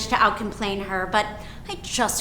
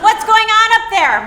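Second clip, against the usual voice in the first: high, loud call through the house; yelling from downstairs; 7 semitones higher, 6 dB louder than usual. Acted. Husband calling up to wife and child, yelling. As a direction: holler up to another floor. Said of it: "my husband's yelling from downstairs".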